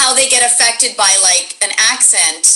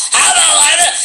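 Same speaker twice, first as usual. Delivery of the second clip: higher, louder mock-evil audition voice; more evil character; 7 semitones higher, 4 dB louder, faster than usual. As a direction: push the farmer into cartoon evil. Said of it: "Actually, he's more evil".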